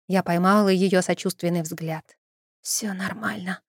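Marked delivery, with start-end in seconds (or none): 2.6-3.6 slow, much softer hoarse croak; hoarse rasp. A narrator's reading. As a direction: rasp it out, barely voiced. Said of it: "прохрипела я".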